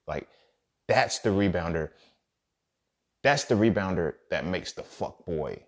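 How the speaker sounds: normal talking voice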